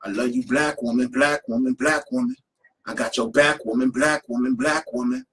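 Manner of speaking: rhythmic spoken-word chant; poem recital. Acted. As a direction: perform it like spoken-word poetry.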